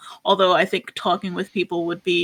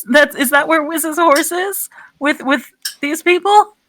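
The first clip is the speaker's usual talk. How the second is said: higher, slower put-on voice; character voice; 8 semitones higher, slower than usual. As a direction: nervously quiz Raoul in character. Player voices Dickie to Raoul.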